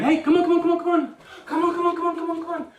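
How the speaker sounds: loud, very high rapid-fire puppy call; high-pitched excited voice. Shown as a demonstration. Trainer calls the puppy again while backing up, high-pitched excited voice. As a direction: get the puppy racing over with loud excited squeals. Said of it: "in a high-pitched, excited voice".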